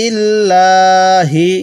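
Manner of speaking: ইনদিল্লাহি with a thick, full লাম in আল্লাহ; heavy lam. Shown as a demonstration. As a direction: give the লাম weight. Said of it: "এই লামটিকে মোটা করে পড়ব". (লাম মোটা করে পড়া)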